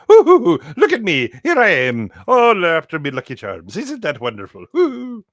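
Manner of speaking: very high, loud, whooping cartoon voice; hooting and preening like a giddy leprechaun. To the stream, showing off his Common People accent. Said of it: "Common People's accent".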